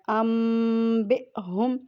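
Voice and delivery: ام بے ہم drawn slowly, the nun turned to a nasal mim; iqlab recitation. Shown as a demonstration. Teacher reciting the correct form for students, slow and nasal. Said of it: "نون ساکن کو میم سے بدلنا ہے".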